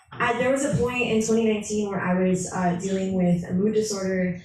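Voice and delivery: ordinary talk, nothing marked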